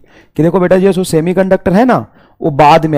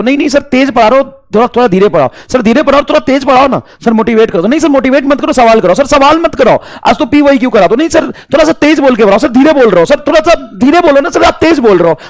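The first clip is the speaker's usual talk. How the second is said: mocking whiny student; 6 semitones higher than usual. Teacher mocking online students' demands, high whine. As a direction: mimic a pestering kid's nagging.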